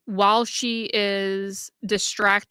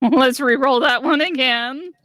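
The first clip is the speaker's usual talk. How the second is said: loud, higher, laughing through it; 5 semitones higher, 7 dB louder than usual. Real, not acted. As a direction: laugh as you ask for it.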